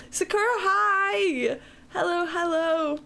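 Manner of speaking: high, squealy hello; excited greeting. Real, not acted. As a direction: greet a friend with delight. Greeting a viewer on stream.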